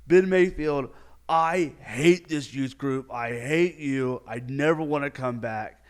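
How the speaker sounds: loud, slow, drawn-out declaration; hammed-up outrage with stretched words; furious skier. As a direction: imitate a furious tantrum, easing toward the end. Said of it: "he was just so mad, so furious".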